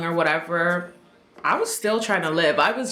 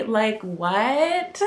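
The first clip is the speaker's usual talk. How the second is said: normal talking voice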